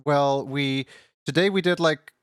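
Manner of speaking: high, sweet streamer voice; bubbly high greeting voice; cute anime streamer. Acted.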